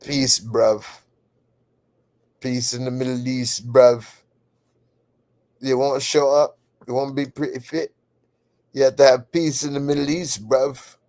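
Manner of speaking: playful British bruv voice; mock British accent. Host signing off to chat, mock British accent. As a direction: sign off like a London lad, British accent.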